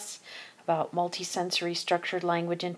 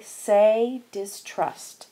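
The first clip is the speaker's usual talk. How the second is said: teacher prompt; 2 semitones higher, 6 dB louder, much slower than usual. Shown as a demonstration. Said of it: "So the teacher would say".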